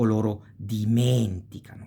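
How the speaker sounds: dimenticano with men long and strong; stress on fourth-to-last. Shown as a demonstration. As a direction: stretch di-MEN, then run through ticano. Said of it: "falls on the fourth to last".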